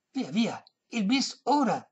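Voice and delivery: high, coaxing demand; insistent. A narrator's reading. Old lady demanding her encore, insistent high voice. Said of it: "insisté la vecchia".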